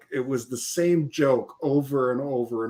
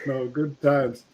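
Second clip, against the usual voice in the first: usual speaking voice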